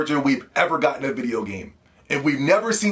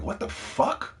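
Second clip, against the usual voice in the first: stunned; 5 semitones higher, 8 dB quieter, slower than usual. Retold or imitated. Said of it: "I'm like".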